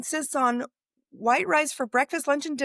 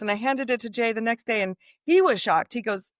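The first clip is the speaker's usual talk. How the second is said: normal talking voice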